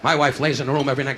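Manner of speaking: usual speaking voice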